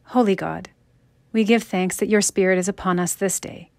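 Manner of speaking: normal talking voice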